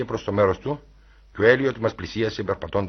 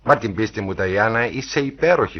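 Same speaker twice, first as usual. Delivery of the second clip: ordinary delivery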